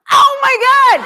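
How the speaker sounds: high, loud, delighted shriek; thrilled shout. Real, not acted. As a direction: scream it out in sheer delight. Mother thrilled by TV caption.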